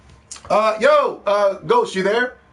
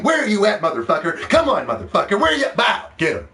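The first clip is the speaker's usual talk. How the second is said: high, loud playful taunting hunt; excited hunt; 7 semitones higher, 4 dB louder than usual. Pretend. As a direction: call out to the Pikachu as you chase it. Acting out hunting Pikachu.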